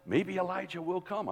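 high, softened, hopeful wondering; note of hope. Acted. Onlookers at the cross awaiting Elijah, hopefully. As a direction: lift it softly with dawning hope. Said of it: "it was a note of hope".

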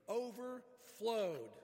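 overflowed drawn out loud and high; stress on overflowed. Real, not acted. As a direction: punch overflowed, stretching it slowly. Stressing the verse's first, emphasized word.

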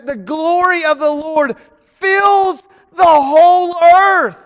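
loud, rising emphasis on the whole earth; preaching shout. Real, not acted. Pastor proclaims God's glory to the church, loud. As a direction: build and hit earth hard.

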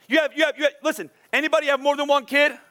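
ordinary delivery

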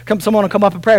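ordinary talk

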